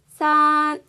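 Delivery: 산 sung high and held level. Shown as a demonstration. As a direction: hold 산 high and flat. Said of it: "이 발음을 생각해 보시면".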